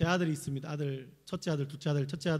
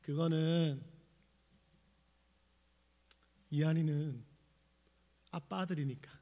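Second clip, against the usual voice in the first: put-on tender child answer; slower than usual. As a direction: let each word land gently, like a small boy. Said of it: "이렇게 말하더라고요".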